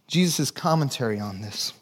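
ordinary talk, nothing marked

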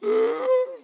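sharp high gasp; panicked gasp. Retold or imitated. Pastor acting out panic mid-swim, sharp gasp. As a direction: suck in air like water hit you.

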